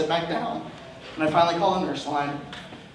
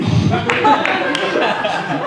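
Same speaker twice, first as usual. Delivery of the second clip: laugh; 4 semitones higher, 4 dB louder than usual. Real, not acted. Laugh after joke.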